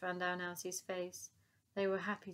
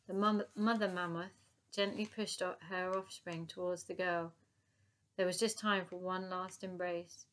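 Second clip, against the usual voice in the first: plain reading voice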